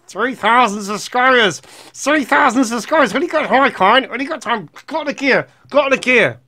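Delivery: high, loud put-on voice; hammed-up squeal of an overexcited fan; excitable imagined viewer. Voices imagined viewers hoping for notice.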